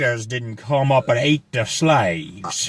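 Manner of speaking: bad Louisiana accent. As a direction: ham up a Louisiana plantation-country accent. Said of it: "I can't do a Louisiana accent".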